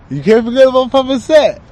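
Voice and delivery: high, loud, strange channeled spirit voice; channeled Papa Said voice. Pretend. Channeler lets Papa Said speak through him, high and loud. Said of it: "when I speak like that".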